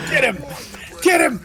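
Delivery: excited yelling. Real, not acted. Chasing the chicken in game.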